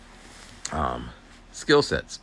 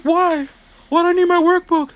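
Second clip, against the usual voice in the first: much higher, much louder defiant whine; whiny student voice; 11 dB louder than usual. Retold or imitated. Student whining back at the aide about the workbook, loud and high. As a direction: push back like a sulky kid.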